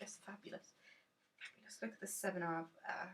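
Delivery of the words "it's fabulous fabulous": whispered admiration. Real, not acted. Admiring card softly.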